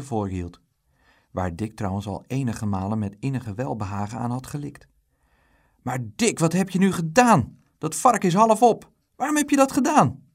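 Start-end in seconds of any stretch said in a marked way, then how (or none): 5.8-10.2 loud, high outcry building in pitch; indignant outburst, climbing higher and louder; scolding mother. A narrator's reading. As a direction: scold a child, rising to a high shout. Mother scolds Dick over eaten pig.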